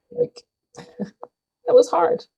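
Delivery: real tears, voice cracking high and slow; fighting back tears through a broken laugh; voice breaking. Real, not acted. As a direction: daughter breaking down remembering her mom, tearful and slow. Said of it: "I've been crying".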